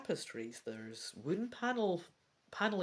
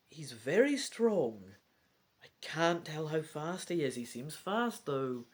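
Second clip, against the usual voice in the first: ordinary talk, nothing marked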